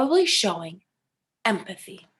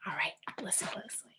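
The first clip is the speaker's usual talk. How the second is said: whispering; 6 semitones lower, 15 dB quieter, faster than usual. Acted. Whispered lead-in to video.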